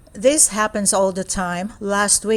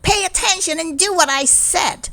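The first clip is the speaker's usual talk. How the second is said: high, stern teacher voice, raised pitch; mean teacher; 10 semitones higher than usual. Acted. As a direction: bark it like a strict teacher. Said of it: "She says".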